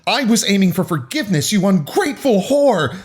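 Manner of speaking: high-pitched angry shout; acted high, indignant yell. A narrator's reading. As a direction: shout the insult in outrage. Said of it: "Haley shouted".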